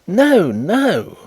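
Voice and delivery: sharp indignant no, no; indignant cry. A narrator's reading. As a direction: cut him off in outrage. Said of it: "cried Mister Carlyle indignantly".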